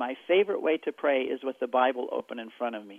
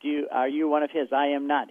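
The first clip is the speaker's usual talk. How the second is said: ordinary talk, nothing marked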